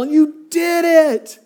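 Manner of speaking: high, slow, delighted cheer; proud dad whooping. Retold or imitated. Cheering daughter at hill bottom.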